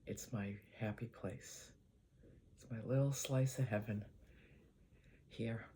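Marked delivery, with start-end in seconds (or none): none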